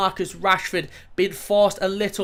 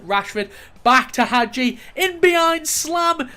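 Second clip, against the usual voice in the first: excited play-by-play; 8 semitones higher, 5 dB louder than usual. Real, not acted. Gamer calling his striker's shot, excited. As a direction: voice jumps high and loud on the shot. Live goal being scored.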